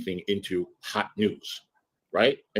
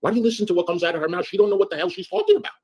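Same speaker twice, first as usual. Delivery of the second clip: much higher, fast, louder mock Robert rant; imitating Robert exasperated; 9 semitones higher, 4 dB louder, faster than usual. Friend Robert telling the host to stop listening, exasperated imitation. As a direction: rattle it off as Robert fed up with her. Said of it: "he's like".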